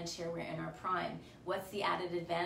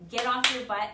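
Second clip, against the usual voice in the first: emphatic push; 4 semitones higher, 9 dB louder than usual. Real, not acted. Urging viewers to act.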